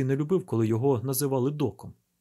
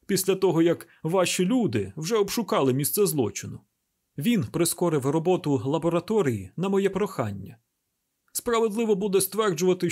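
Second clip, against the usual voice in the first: mildly indignant retort, pitched higher; snapping back; 6 semitones higher, 3 dB louder than usual. A narrator's reading. Medical expert rounding on a press officer, mildly indignant. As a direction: round on him with a touch of anger. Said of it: "Прінсі накинувся на нього".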